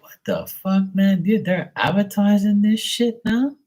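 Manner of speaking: high, disbelieving cry; can't believe they're advertising it; incredulous outburst. Retold or imitated. On learning the robots are advertised, high disbelief.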